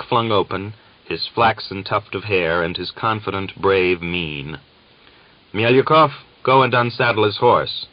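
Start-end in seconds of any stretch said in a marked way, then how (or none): 5.5-7.9 higher, louder barked order across the camp; sergeant's order. A narrator's reading. Sergeant orders a Cossack to unsaddle the horse, loud command. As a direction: snap the command at Mielyukov. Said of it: "the sergeant ordered".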